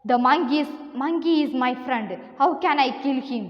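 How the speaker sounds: usual speaking voice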